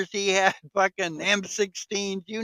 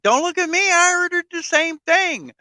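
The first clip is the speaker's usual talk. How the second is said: pitched way up, mock defensive; high mock diner voice; 12 semitones higher than usual. Friend retelling the diner scene, high mock voice. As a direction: squeak it out like a nervous bystander.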